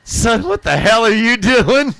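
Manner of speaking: high, loud, slow scolding cop; stern cop. Retold or imitated. Border cop catching him peeing, a loud slow scold. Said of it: "he's just like".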